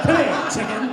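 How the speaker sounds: high, loud possum lunge. Acted. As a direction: call out as a possum going after prey. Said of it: "Looks at a chicken and goes".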